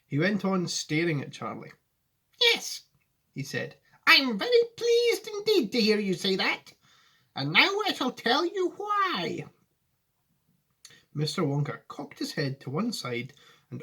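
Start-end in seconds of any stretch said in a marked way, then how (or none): 2.4-2.8 high, earnest Wonka voice; a grave old showman, pitched up; serious Wonka. Acted. Wonka answering Charlie seriously, in a high voice. 4.0-9.5 high, earnest Wonka voice; a grave old showman, pitched up; serious Wonka. Acted. Wonka answering Charlie seriously, in a high voice.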